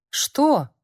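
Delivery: high, thin startled question; small high voice, caught off guard. Acted. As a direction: Yana asking again in a thin high voice. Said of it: "тонким голосом переспросила Яна".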